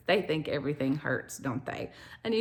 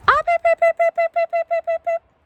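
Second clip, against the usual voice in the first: high, loud, rapid-fire beeps; chicken call; 8 dB louder than usual. Real, not acted. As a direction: call the hens with shrill staccato beeps. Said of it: "That's how I say, "Come here, chickens".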